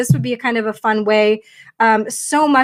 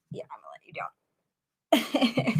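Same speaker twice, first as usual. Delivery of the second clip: whispered aside, slow; 10 dB quieter, slower than usual. Real, not acted. Setting the puppy down, quiet whisper. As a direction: hush it to the puppy while setting him down.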